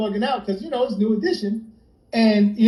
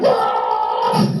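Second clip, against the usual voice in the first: mock dismissive groan. Mocking the group name.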